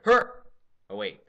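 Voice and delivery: ordinary talk, nothing marked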